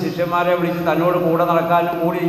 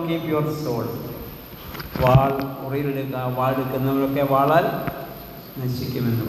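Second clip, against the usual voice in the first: lower, subdued retold sorrow; sorrowful; 4 semitones lower, 5 dB quieter than usual. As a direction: quote it with quiet sadness, voice sinking. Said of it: "ദുഃഖത്തോടെ പറഞ്ഞു". (ദുഃഖത്തോടെ, താഴ്ന്ന സ്വരത്തിൽ)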